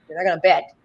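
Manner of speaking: normal talking voice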